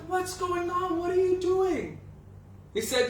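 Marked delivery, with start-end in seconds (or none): 0.1-2.0 much higher, startled mother's voice; high alarmed cry of a mother. Retold or imitated. Yashoda sees Krishna marching out.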